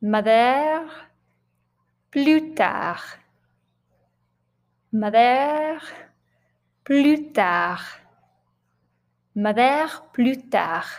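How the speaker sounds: French-accented model pronunciation, each word set apart; light French accent. Shown as a demonstration. Teacher modelling a character's name for learners, light French accent. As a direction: say it like a French name, end of "Mother" lifted. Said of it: "with a little French accent".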